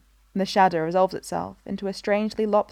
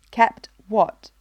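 flat; 2 semitones higher, 5 dB louder than usual. Acted. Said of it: "Breha asks flatly".

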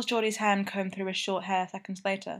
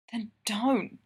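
whisper; 2 semitones higher than usual. A narrator's reading. Tyler to Geordie in the back seat, whispered. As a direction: urge him on under your breath.

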